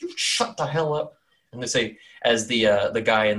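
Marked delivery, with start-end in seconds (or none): 0.0-1.1 annoyed hushing. Acted. Townsfolk turn on omen woman.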